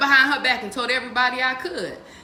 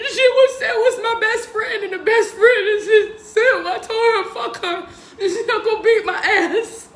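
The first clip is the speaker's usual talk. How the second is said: high, loud laughing retelling; real laughter through the words; laughing while talking; 12 semitones higher, 7 dB louder than usual. Real, not acted. Laughing through retold outburst.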